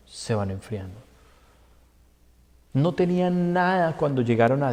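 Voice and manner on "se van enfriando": much softer and lower, dying out; fading away. Shown as a demonstration. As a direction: let it fade to almost nothing.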